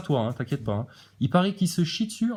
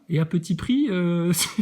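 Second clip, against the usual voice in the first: ordinary talk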